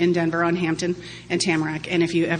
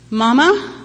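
higher, louder call; 7 semitones higher, 8 dB louder than usual. Retold or imitated. Toddler calling his mother from behind the door. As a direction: call through the door for mom.

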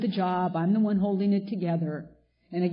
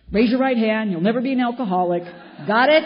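put-on loud, firm order to the little boys; stern mother command; 4 semitones higher, 8 dB louder than usual. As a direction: lay down the law to the kids, loudly. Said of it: "they're like little dudes".

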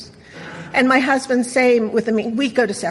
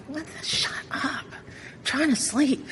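hissed, annoyed whisper, much softer and slow; sleepy whisper; 3 semitones higher, 9 dB quieter, slower than usual. Retold or imitated. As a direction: shush the dog half-asleep. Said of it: "I'm like".

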